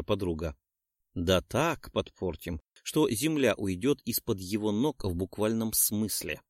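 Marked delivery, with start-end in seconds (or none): none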